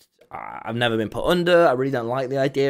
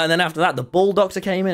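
ordinary talk

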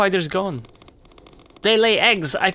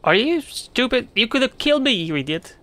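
higher, indignant snap; higher mock fury; angry scolding; 7 semitones higher than usual. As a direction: tell him off for nearly killing her. Crystal scolds the ringmaster.

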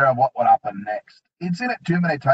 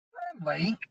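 high, soft scornful echo; scoffing; 8 semitones higher, 9 dB quieter than usual. A narrator's reading. Wartface scoffing at the dwarf's claim, high and scornful. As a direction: scoff at the word. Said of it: "scoffed Wartface".

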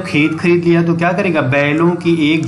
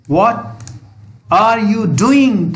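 mild angry demand; 4 semitones higher, much slower than usual. Acted. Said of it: "वो गुस्से से चिल्लाया".

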